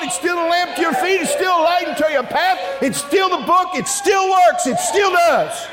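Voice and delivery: high, driving sermon shout; impassioned preaching. Real, not acted. Preacher to church on the eternal Bible, full-force preaching. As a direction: hammer each claim home at high pitch.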